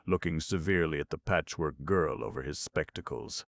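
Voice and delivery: plain reading voice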